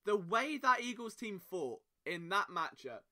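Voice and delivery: ordinary talk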